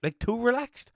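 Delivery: high, hesitant voicing of himself; high unsure quote. Retelling his reply to his mate, high and unsure. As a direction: puzzle it out in a high voice. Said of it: "I was kinda like".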